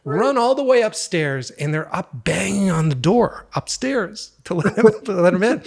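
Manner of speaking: high, loud laughing through the story; can barely get the words out for laughing; retelling the prank to a friend, laughing hard and loud; laughing while telling. Real, not acted. My mother and I are laughing so hard.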